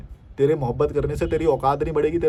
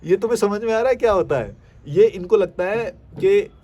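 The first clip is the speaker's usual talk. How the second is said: higher, louder incredulous question; 5 semitones higher, 5 dB louder than usual. Real, not acted. Vlogger asking viewers in disbelief, loud and high.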